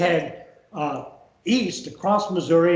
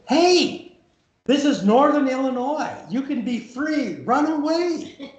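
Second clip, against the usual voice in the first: calling out; 8 semitones higher, slower than usual. Retold or imitated. Irish workmen call from bank.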